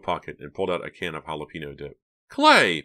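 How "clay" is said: high, loud exclamation; scandalized, pitched-up shout; shocked cry. A narrator's reading. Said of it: "Pam cried, truly shocked".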